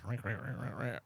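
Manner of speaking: rapid string of cat meows; imitated cat meowing. Man shows the smug cat demanding food, mock meowing. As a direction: mimic a smug cat's meows. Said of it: "where he goes".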